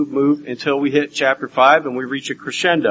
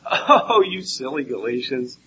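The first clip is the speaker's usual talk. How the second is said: mild teasing. Retold or imitated. Preacher mimicking others' gentle reading of Paul, mildly teasing. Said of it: "make it seem like Paul is saying".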